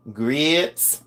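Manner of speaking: GRITS held long as "greets", rising; mock Southern "greeeets"; imitated Southern drawl. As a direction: mimic a Southern, stretched vowel in GRITS. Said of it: "I love their accent".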